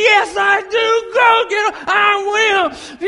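loud, high chanted reply; players shouting back. Retold or imitated. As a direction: bellow it back like a fired-up team. Boys shouting back to coach.

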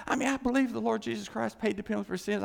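normal talking voice

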